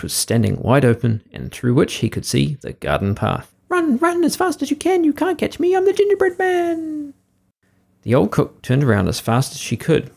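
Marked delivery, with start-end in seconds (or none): 3.7-7.1 very high, tiny cartoon voice taunting; sing-song it like a cheeky little cookie; squeaky gingerbread man. Gingerbread man taunts the cook, squeaky high voice.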